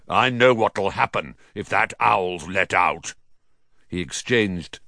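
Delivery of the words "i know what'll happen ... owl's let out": understated uncle's snarl. A narrator's reading. Uncle refusing to free the owl, snarling. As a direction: growl it with suspicion. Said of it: "snarled Uncle Vernon".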